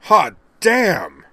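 loud high triumphant whoop; shouted victory cry; bursting out. Acted. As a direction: explode with joy at the win. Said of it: "Winston Zeddemore burst out".

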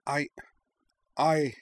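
high, halting stammer; flustered false start with a gap. A narrator's reading. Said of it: "Nima stammered".

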